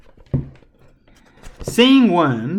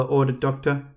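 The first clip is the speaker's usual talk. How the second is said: ordinary delivery, nothing marked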